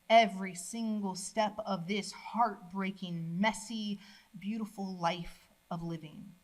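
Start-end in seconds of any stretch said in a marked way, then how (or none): none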